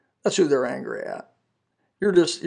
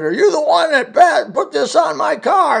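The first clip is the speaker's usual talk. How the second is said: high, loud mock brute yelling; hammed-up high angry bellow; mock angry neighbor; 12 semitones higher, 8 dB louder than usual. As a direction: play the big angry guy confronting the preacher. Angry man confronts him.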